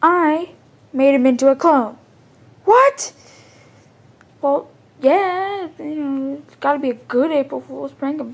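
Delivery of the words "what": sudden high, loud cry of disbelief; shocked outburst. Acted. Brother hearing the clone prank, shocked high cry. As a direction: blurt it out, stunned.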